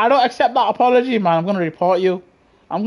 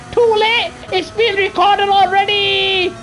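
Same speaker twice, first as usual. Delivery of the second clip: higher, slow gloating taunt; 7 semitones higher, slower than usual. Real, not acted. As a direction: rub it in, stretched out and singsong. Taunting kids in the chat.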